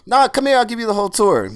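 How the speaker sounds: put-on high, loud proud old man. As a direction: announce your famous guests with swagger. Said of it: "And he's like".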